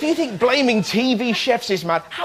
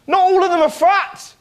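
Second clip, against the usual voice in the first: mock outrage; 8 semitones higher than usual. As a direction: protest high and outraged. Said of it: "And you're like".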